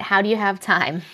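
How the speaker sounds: usual speaking voice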